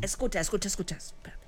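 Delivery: softly hushing, dropping to whisper; shh, listen closely; hushed. Real, not acted. Hushing to hear the clip.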